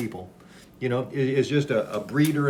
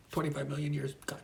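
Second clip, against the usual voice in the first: normal talking voice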